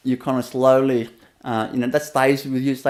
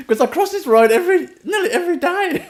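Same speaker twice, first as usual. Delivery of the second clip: high, laughing through the words; 13 semitones higher than usual. Real, not acted. Telling a listener about a childhood scolding, laughing high. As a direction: giggle it out, amused at the memory.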